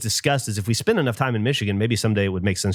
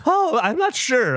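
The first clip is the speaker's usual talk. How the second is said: high, slow, unsure voicing of his wife; hesitant and hurt; doubtful wife; 13 semitones higher, slower than usual. Wife still doubting him, high slow unsure voice. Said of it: "And she was like".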